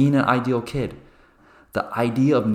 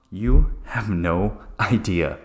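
knowing deadpan; slower than usual. Real, not acted. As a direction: warn them darkly, slowly. Ironic reply to friends.

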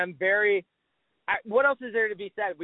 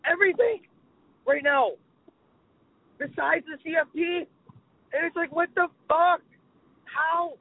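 exasperated rant; 7 semitones higher than usual. Real, not acted. Venting about playoff ranking.

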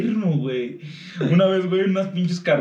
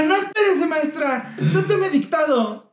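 high, slow pleading schoolboy voice; a prissy kid asking the teacher to hold on, slow and high; mock nerdy classmate; 10 semitones higher, slower than usual. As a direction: whine it like the teacher's pet begging her to wait. Classmate stops teacher to wait.

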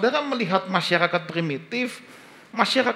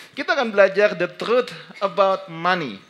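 usual speaking voice

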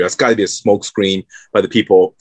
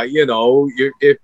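ordinary talk, nothing marked

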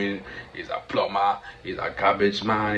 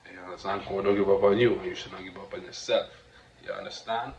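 ordinary delivery

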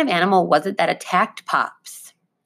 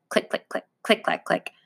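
clack it out quietly like chattering teeth; clicking teeth; 5 dB quieter than usual. Retold or imitated. Grandfather imitating the porcupine's teeth, soft clicks. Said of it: "It sounded like this".

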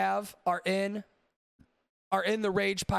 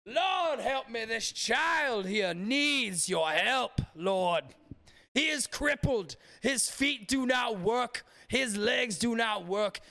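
mock megachurch preacher; 3 semitones higher than usual. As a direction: parody a faith healer working the congregation. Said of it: "mega churches that we make fun of nowadays".

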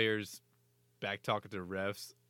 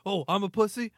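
much higher, louder taunting bench shout; mock screaming; 10 semitones higher, 11 dB louder than usual. Two players screaming between the benches, mock shout. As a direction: scream back at the other bench. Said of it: "just screaming at each other".

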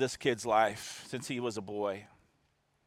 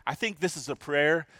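ordinary talk

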